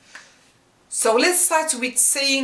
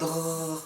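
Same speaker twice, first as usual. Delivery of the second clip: a soft gargled r deep in the throat; gargling r; 5 semitones lower, 9 dB quieter than usual. Shown as a demonstration. Teacher demonstrates the gargling ghain sound. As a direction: gargle the r quietly. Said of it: "it's the gargling sound".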